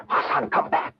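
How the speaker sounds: hushed, urgent call; breathy urgent whisper. Acted. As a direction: whisper so the sentry won't hear. Hiding from sentry, calls softly.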